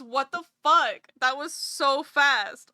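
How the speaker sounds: loud, amazed retelling of a friend's reaction; imitating a stunned, delighted friend; excited outburst. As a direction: blurt it out in happy disbelief. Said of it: "and Rez goes".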